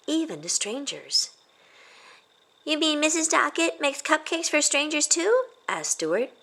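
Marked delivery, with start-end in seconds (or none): 2.6-5.5 put-on high, eager kid's question; child voice. Stuart asking his mom about the kind lady. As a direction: ask it like a curious little boy.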